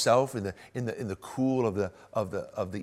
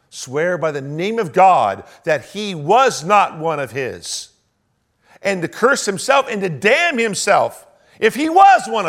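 forceful preaching; 7 semitones higher, 12 dB louder than usual. Acted.

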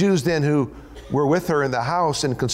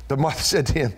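ordinary delivery

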